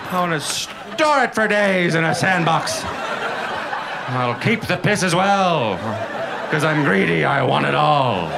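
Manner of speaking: sneering parody of a cat owner; smug cat owner. Retold or imitated. Mocking cat owner persona.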